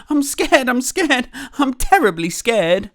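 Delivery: high, loud fearful wail; repeated frightened cry; terribly scared. Acted. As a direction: panic like a timid cook.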